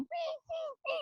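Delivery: high, soft whimpering squeal; thin little mock whimpers; imitated puppy whine. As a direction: whine like a puppy shut in a kennel. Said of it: "She was whining in there".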